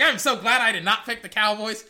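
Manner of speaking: loud relieved quote. Retold or imitated. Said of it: "I'm like".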